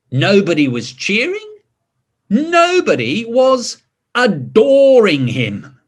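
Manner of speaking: slow, grand, then deflating read-aloud; dramatic storybook narration. A narrator's reading. Reading to children about the king's failed bow, slow dramatic narration. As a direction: build him up, then let it drop.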